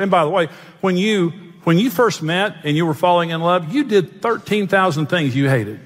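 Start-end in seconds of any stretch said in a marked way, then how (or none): none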